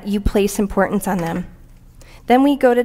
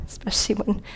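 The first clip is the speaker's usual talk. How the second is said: whispered laugh; 8 dB quieter than usual. Real, not acted. Joke about her spouse.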